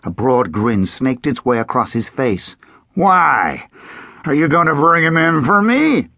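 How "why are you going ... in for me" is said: grinning, mocking old lawman; sly sheriff. A narrator's reading. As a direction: tease him, amused and sly.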